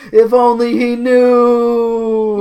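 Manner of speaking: loud, high, very drawn-out lament; hammed-up melodrama. A narrator's reading. Narrator hamming up the secret, loud slow melodramatic wail. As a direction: wail it out, stretching every word.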